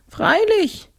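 very high, tearful-sounding ghost voice; whiny ghost. A narrator's reading. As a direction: whine it high as a sorry ghost. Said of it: "sagte das Grafengespenst weinerlich". (weinerliche, hohe Gespensterstimme)

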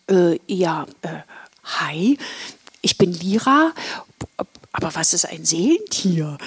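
halting, tentative introduction with pauses; hesitant. Acted. Girl introduces herself to two strangers, hesitant. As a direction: stumble into your hello, then ask puzzled. Shy newcomer answers the two girls.

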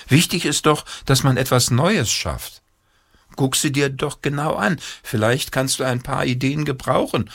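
father urging his son on; encouraging father. Acted. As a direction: coax the child to look closer.

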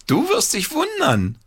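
put-on high excited boyish call. A narrator's reading. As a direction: tease them with a secret. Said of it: "rief Paul".

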